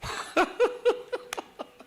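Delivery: high, breathy burst of laughter; a sudden high cackle. Retold or imitated. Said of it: "Ramalingam started to laugh".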